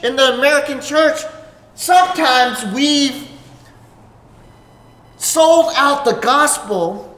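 rising preacher's fervour, slowed and pointed; impassioned preaching. Acted. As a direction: press each question home from the pulpit. Preaching at full force.

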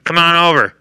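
loud, high, booming pitchman through a tiny mic; blender demo guy. Retold or imitated. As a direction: hawk the strawberries to the whole store like a demo salesman. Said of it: "it sounds like he's talking like this".

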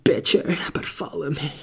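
mock whisper. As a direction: whisper the invitation dramatically. Acted melodramatic whisper.

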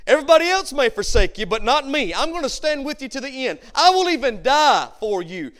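loud, high, emphatic boast. Retold or imitated. Peter boasting to Jesus before the cross, boastful. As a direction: declare it forcefully as a bold vow. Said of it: "Peter boasted right before Jesus went to the cross".

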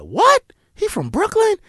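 high, rising startled question; disbelief. Retold or imitated. Learning the victim was from Brooklyn, high disbelief. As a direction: snap up in shock. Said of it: "I said".

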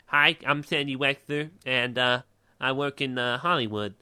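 lisping, slow goofy voice; slow put-on voice with a lisp; Adam Sandler lisp. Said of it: "voice with, with a bit of a lisp".

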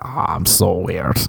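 low, drawn-out "so weird"; mock LA beautiful person. Beautiful people in LA describing themselves, in a mock affected voice. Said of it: "they'll be like".

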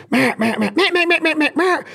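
high nasal-free meh calls voiced like a duck; imitated duck greeter, climbing high. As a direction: mimic a greeter call, pitched well up. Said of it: "slower greeter".

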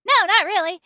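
high, loud retold denial; emphatic denial. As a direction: re-enact an oblivious, emphatic no. Own reply to agents acted out.

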